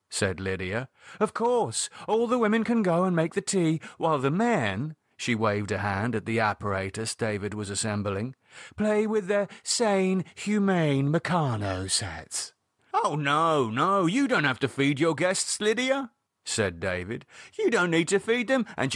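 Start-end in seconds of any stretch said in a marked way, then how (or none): 1.2-4.9 high-pitched, indignant sarcasm; mock his orders with scornful agreement; sarcastic. Lydia sent off to help with food, sarcastic. Acted quarrel, sarcastic retort. 8.8-12.5 slow, drawn-out scornful mockery; mocking. Lydia throwing David's words back at him, mocking. 12.9-16.1 higher-pitched, emphatic objection; irritated retort. Acted. David contradicting Lydia about her guests, irritated. Acted quarrel over guests.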